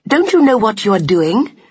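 high, urgent reproachful question; distressed cry. A narrator's reading. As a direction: scold him in alarm. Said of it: "she cried".

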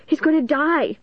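high-pitched panicked cry; frantic, pitched far up; shrieking. A narrator's reading. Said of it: "she shrieked".

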